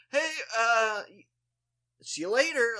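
high, loud, halting farewell; awkward goodbye. Retold or imitated. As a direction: imitate a stumbling, too-loud exit line. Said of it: "I shuffled off awkwardly".

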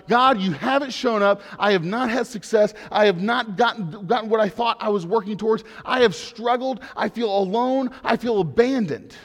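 acted outcry, pressing each complaint home; crying out. Preacher voices brutally honest prayer to God, crying out. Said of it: "brutal honesty of crying out to God".

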